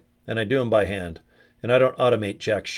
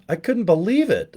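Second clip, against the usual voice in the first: usual speaking voice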